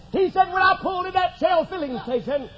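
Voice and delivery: high, building revival cry; exultant pulpit shouting, voice pitched high; full-force preaching. Real, not acted.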